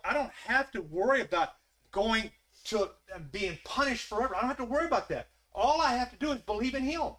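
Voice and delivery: high, loud, driving sermon climax; rising, insistent declarations of faith; full-force preaching. Acted. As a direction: preach it up to the rafters.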